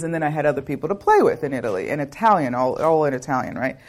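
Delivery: normal talking voice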